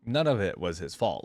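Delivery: usual speaking voice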